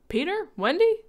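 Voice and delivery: high, rising call; questioning call. Acted. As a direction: call out unsure where they are. Parents searching for children.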